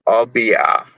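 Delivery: normal talking voice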